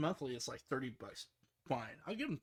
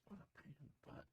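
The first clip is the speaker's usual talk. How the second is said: muttered grumble; 18 dB quieter than usual. Real, not acted. Fighting with the window.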